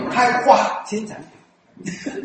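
开花 said slowly with F turned to H, in a Taiwanese accent. Shown as a demonstration. Showing how Taiwanese speakers say fa as hua, slow Taiwanese accent. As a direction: drag out 开花新产品 in a Taiwanese accent, F as H. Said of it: "我们台湾人讲".

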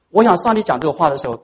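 normal talking voice

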